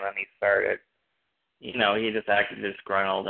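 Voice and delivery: normal talking voice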